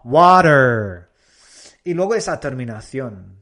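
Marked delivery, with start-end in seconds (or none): 0.0-1.1 loud WATER as WAH-derr; American WATER, open first vowel; US pronunciation. Shown as a demonstration. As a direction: punch out American WATER with final r. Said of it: "U S".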